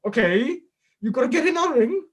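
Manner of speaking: mock-sweet coaching in a Welsh lilt; [name]. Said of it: "I don't know why I put that accent on".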